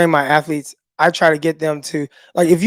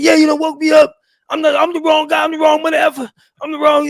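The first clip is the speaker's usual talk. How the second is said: high, loud, amped-up frog-like yelling; fired-up Mahomes; 10 semitones higher, 5 dB louder than usual. Retold or imitated. Imitating a hyped Mahomes after his touchdown pass, high mock yelling. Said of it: "just like Kermit going crazy".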